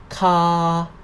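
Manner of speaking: "Ka" held level at mid pitch. Shown as a demonstration. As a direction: sustain "Ka" on one even tone. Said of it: "no tone mark represent the mid tone".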